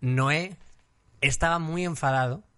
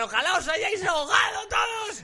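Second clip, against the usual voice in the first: put-on high drunken shout; drunk yelling; 13 semitones higher than usual. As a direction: yell it like a bitter drunk.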